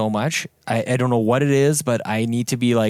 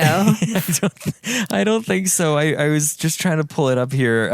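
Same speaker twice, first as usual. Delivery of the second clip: real laughter breaking into higher-pitched speech; laughing hard; 5 semitones higher, slower than usual. Real, not acted. Podcaster answering co-host about the eShop, laughing hard. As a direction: talk through a fit of laughter. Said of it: "That's why I'm laughing so hard".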